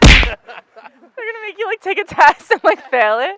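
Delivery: high, loud and drawn-out teasing question; playful teasing. Real, not acted. Teasing a friend about her fear of failure, high and loud. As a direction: stretch it out to tease her about failing.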